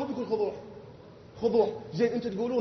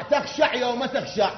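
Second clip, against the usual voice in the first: normal talking voice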